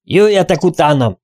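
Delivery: loud, high urgent call; shouted. A narrator's reading. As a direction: call everyone after you. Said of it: "kiáltotta közbe".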